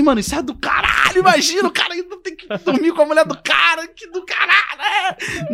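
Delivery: high-pitched, worked-up excitement; squealed inner exclamation; gleeful disbelief. Retold or imitated. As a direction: rave at how outrageous the idea is. Gleeful imagined reaction to prank.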